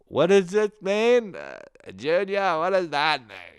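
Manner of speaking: high, slow, drawn-out befuddled wail; hammed-up helpless old parent voice; confused parent. Baffled parent calling Junior for tech help, confused.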